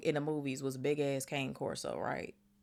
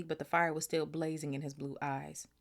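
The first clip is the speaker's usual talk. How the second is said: ordinary delivery, nothing marked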